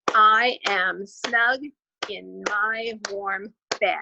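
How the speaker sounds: slow, one beat per syllable; clapped syllables. Shown as a demonstration. Teacher to grade twos, slow syllable-by-syllable clapping. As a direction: tap out every syllable slowly. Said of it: "I'll clap it along as we go".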